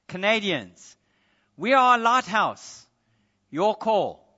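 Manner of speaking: loud, unbothered final reply; loud deadpan punchline. Retold or imitated. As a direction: deliver the punchline flatly but loud. Punchline of the joke.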